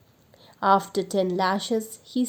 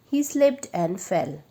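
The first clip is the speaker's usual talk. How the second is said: usual speaking voice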